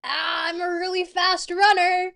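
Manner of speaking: mock boast. Sudden high loud outburst.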